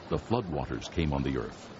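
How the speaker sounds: ordinary talk, nothing marked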